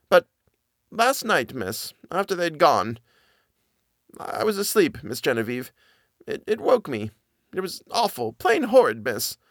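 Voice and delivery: high, halting servant voice, stumbling on the words; hesitant frightened nanny. Acted. Nanny stammers her story to Miss Genevieve, high and halting. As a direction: force out a secret she dreads telling.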